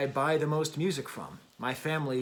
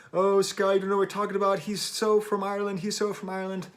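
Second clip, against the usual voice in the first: higher, louder mock voice of know-it-all commenters; 5 semitones higher, 4 dB louder, faster than usual. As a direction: parody the critics in a higher, louder, whiny tone. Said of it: "All these people started just".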